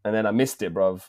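usual speaking voice

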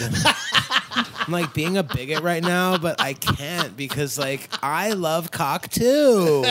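camp, drawn-out words with laughter. Shown as a demonstration. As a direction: exaggerate a flamboyant lilt, stretching the stressed words.

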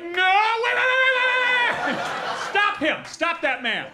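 high, loud, drawn-out "wait"; mock desperate shout. Fan yelling after a departing celebrity, mock desperate shout. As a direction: call frantically after someone getting away. Calling after man walking away.

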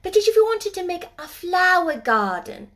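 put-on a child pointing out the bird; lightly voiced girl. Mary spotting the robin for Ben, eager girl's voice.

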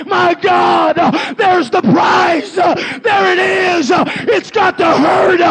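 loud, high, slowed shouted preaching; full-force preaching. Acted. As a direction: preach it to the rafters.

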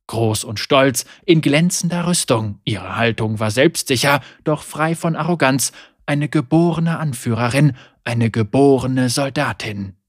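usual speaking voice

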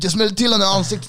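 high, loud and fast repeat; excited rushed brag. Retold or imitated. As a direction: rattle it off again, hyped. Friend repeats the boast, faster.

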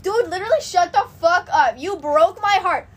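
heated retelling, slowing to hammer each word; exasperated outburst. Retold or imitated. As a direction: throw it back at him, emphatic. Acting out angry reply to ex.

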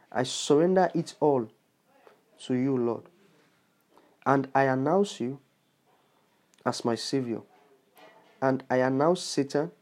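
unhurried prayer, a long pause after each line; prayer paced for listeners to repeat. Shown as a demonstration. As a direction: lead the prayer line by line slowly. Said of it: "Okay, let's go again".

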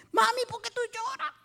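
put-on high-pitched child questioning mother; high childlike retold question; imitated little child. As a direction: play a small child asking mom. Voicing herself as a child.

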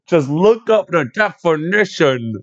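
exaggerated imitation of a heated kid; mock ranting kid. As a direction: mimic a kid's indignant outburst, stretching each word. Mimicking the kid's heated rant.

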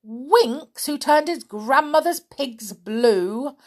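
loud, high, outraged witch; witch crying out. A narrator's reading. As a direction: shock turning to fury. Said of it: "cried the witch".

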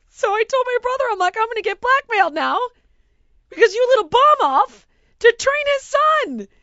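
high, loud indignant rant; worked-up, high-pitched storytelling shout; exasperated yelling. Retold or imitated. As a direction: yell it in comic outrage at your brother. Recounting outrage at brother over blackmail.

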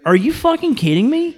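high-pitched disbelief; outraged, voice shooting up; incredulous. Real, not acted. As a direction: react as if you cannot believe it. Incredulous at the president's remark.

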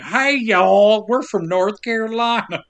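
broad mock Carolina twang; Southern accent. Co-host mocking how North Carolinians talk, Southern accent. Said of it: "they're not like".

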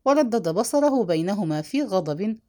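usual speaking voice

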